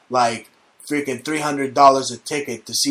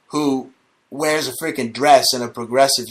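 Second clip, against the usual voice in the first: ordinary talk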